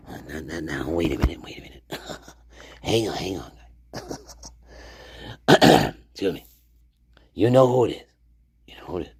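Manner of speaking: hushed whisper, barely voiced. Real, not acted. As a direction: whisper it like a secret aside. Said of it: "whispering asides".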